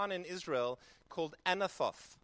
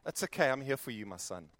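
tender reassurance; 5 semitones lower than usual. Pretend. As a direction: hold him close with your voice. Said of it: "the Father coming around and just saying".

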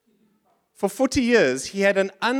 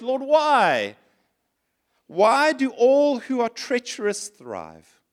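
slow, higher pleading read; a touch of dramatic lament; scripture reading; 4 semitones higher, much slower than usual. Acted. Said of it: "I want to read this to you".

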